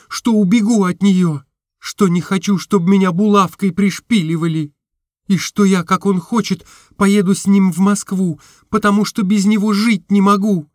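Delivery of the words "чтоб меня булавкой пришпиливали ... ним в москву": usual speaking voice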